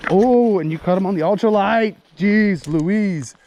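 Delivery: high, loud and thrilled; excited. Real, not acted. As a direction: cheer a buddy's big catch. Friend hooks a big fish.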